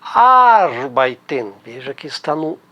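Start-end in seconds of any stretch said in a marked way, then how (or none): 0.0-1.5 Arbeiten, slow, with a long stressed AR. Shown as a demonstration. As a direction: stretch the first syllable of Arbeiten. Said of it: "A R".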